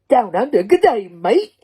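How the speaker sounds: put-on Aussie accent. Mock Aussie greeting.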